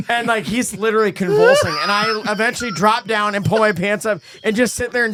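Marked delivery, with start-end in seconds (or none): none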